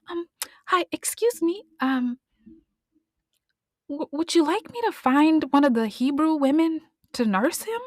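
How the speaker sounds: put-on timid little girl. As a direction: ask shyly like a small girl before a princess. Little sister asks the princess.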